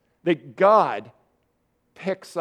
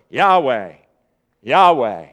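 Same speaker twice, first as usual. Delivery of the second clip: solemn proclamation; 5 semitones lower, 6 dB louder than usual. Acted. Proclaiming the divine name.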